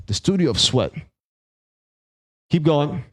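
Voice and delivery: usual speaking voice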